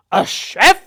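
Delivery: loud, incredulous Welsh schoolmaster; Welsh teacher voice. Retold or imitated. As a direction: scoff at the idea in a Welsh accent. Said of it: "he said".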